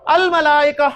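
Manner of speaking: अल-मलाइका with a clearly sounded L; lam pronounced. Shown as a demonstration. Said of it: "इस लफ्ज में मैं लाम पढ़ रहा हूं".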